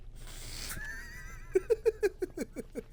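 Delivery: breathless high-pitched giggle; high squealing laugh. Real, not acted. Gamers laughing after a horde scare, squealing giggle. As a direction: laugh off the fright.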